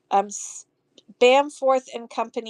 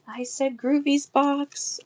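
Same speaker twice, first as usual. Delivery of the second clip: ordinary delivery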